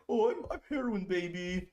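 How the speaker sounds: high, put-on silly voice; mock voice. Streamer mocking Dylan, high goofy voice. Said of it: "He was like".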